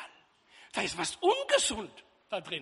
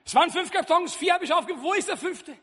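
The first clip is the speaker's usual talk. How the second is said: raised, louder priest repeating; the priest repeats it louder through the confessional; 5 semitones higher, 6 dB louder, faster than usual. Retold or imitated. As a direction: speak up louder to the deaf sacristan. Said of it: "Der Pfarrer sagte".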